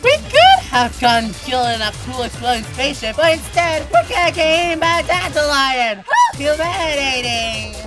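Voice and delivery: put-on high, loud boyish complaint; dismayed cry. A narrator's reading. Harold about to be eaten by a dandelion, wailing. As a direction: wail about the indignity of it. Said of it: "cried Harold".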